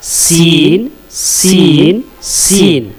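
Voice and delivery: सीन with a clean hissing s; letter demo. Shown as a demonstration. Teacher modelling the letter to students, clean s. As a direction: hiss सीन with the tongue tip behind the teeth. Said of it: "सीन का वही मखरज है".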